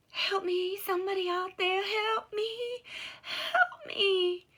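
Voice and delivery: high, loud cry for help; frightened woman on the riverbank crying out for help; desperate woman calling. A narrator's reading. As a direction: plead from far off across the water. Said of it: "The woman on the riverbank called out again".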